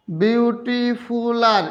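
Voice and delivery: Beautiful আর stretched out very slowly; drawn-out sounding out. Shown as a demonstration. Teacher showing students an impossible comparative, beautiful আর slowly drawn out.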